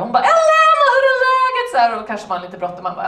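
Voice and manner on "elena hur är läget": much higher, loud shrieking greeting; Svetlana screeching. Retold or imitated. Neighbour calling Elena in the stairwell, high shriek. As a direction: shriek her name up the stairwell in a Russian accent. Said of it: "hon skriker så här".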